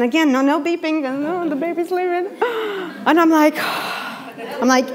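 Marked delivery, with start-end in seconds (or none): 2.3-3.0 startled gasp. Retold or imitated. Woman crosses road suddenly.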